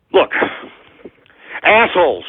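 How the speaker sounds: ordinary talk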